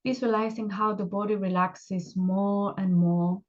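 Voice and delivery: unhurried meditation guide, long pauses between phrases; guided meditation. Acted. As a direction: gently guide them into relaxation, leaving space after each instruction.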